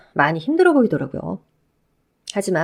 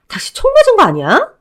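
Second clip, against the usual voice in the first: higher, louder outraged yell; outraged shout; 13 semitones higher, 8 dB louder than usual. Acted. Ex-wife shouting at her shameless ex-husband in outrage. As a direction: blast him with disbelief.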